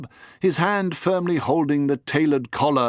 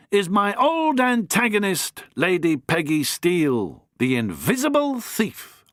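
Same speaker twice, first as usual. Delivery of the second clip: lightly higher, theatrical reveal; the Doctor; 8 semitones higher than usual. Acted. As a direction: unveil the thief to the room.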